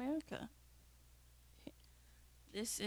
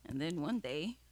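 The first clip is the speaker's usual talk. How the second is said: ordinary talk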